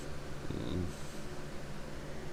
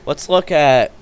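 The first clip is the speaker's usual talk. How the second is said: normal talking voice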